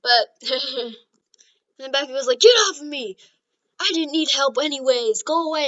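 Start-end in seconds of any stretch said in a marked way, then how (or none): 2.4-5.7 loud, indignant outburst; angry yelling. Acted. Bakugo rejecting Deku's help, angry yelling. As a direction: push them away with your voice.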